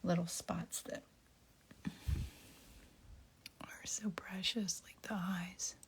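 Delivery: breathless, held-breath whisper; holding breath. Real, not acted. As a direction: speak while holding your breath. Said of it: "Sorry, I'm holding my breath".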